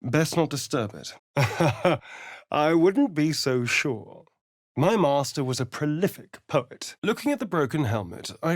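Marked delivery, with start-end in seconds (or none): 1.3-2.5 short, rising amused laugh; a knowing little chuckle; smug chuckle. Acted. Amused reaction in dialogue.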